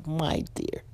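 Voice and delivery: soft put-on English accent; mock British accent. Host answering her co-host's teasing, mock British accent. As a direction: play at being British, softly. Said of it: "practice my British accent".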